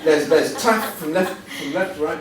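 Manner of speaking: real laughter over the words; laughing. Real, not acted. Laughing at the old friends photo.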